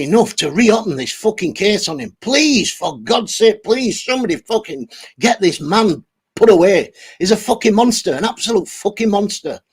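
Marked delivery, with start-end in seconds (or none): none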